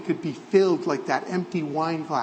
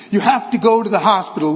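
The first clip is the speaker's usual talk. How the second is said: insisting; 3 semitones higher, 6 dB louder, faster than usual. A narrator's reading. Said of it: "Luca insists".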